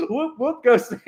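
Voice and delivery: high whoops through laughter; laughing cheer. Real, not acted. Cheers self on while laughing.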